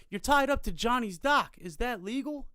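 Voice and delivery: mock officious shouting across water; indignant calling out. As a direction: imitate a nosy neighbour hollering down. Neighbour repeating his challenge louder.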